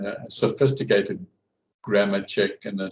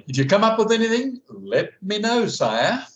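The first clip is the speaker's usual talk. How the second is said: playful mock formal; 11 semitones higher than usual. Jokey mock-formal sign-off.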